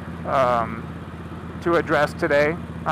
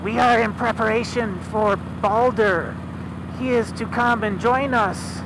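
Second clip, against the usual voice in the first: a lightly voiced seeress, higher; otherworldly prophetess, pitched up; 5 semitones higher than usual. The seeress answering Odin, in a higher voice.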